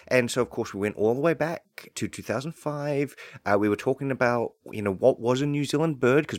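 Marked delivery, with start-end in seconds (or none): none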